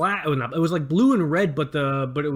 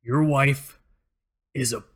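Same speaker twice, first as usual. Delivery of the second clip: halting reluctant; 4 semitones lower, much slower than usual. Retold or imitated. Coworker forced, halting.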